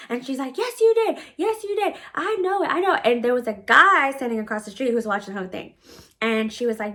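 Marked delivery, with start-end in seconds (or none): 0.6-3.0 high-pitched imitation of an accuser; mock her insistence in a high voice; mocking mimicry. Mimics the screaming woman's accusations.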